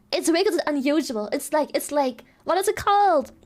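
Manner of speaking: ordinary delivery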